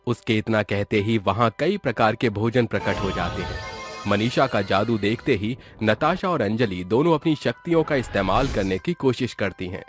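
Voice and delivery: ordinary delivery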